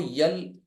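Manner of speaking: "یل" with a y-glide in front, "yal"; regional mispronunciation. Shown as a demonstration. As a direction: say L as "یل", starting on a y sound. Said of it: "کبھی یہ ایل جو ہے".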